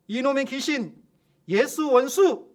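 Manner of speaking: loud, slow rebuke building to a full yell; shouted command. Retold or imitated. Pastor to demon, rebuking it with a loud shout. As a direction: cast the demon out at the top of your voice. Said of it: "라고 소리쳤습니다".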